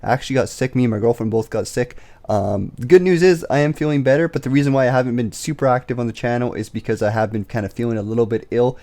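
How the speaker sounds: ordinary talk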